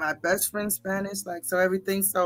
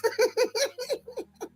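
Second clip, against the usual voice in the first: high, rippling laugh; real high giggle; 4 dB quieter than usual. Real, not acted. Streamer remembering her fifty-four elevens, giggling high.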